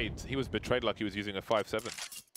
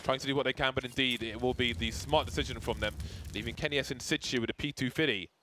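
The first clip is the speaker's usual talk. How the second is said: higher, lively play-by-play; esports caster; 3 semitones higher than usual. Acted. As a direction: call the round live. Live play-by-play casting.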